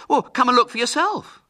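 loud, high-pitched dare; challenge. A narrator's reading. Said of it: "challenged Ginger".

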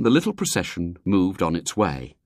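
plain reading voice

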